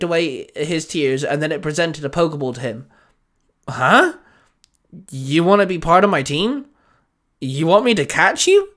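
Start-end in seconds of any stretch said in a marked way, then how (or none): 3.7-8.7 surprised questions rising high at the end; shocked. Acted. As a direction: ask in disbelief, pitch climbing sharply. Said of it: "Baz asked, shocked".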